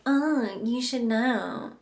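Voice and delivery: drawn-out, snooty put-down; lightly hammed-up snobbish disdain; mock snobby. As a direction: imitate a snotty know-it-all, slow and dismissive. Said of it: "been snobby or snooty or snotty to you".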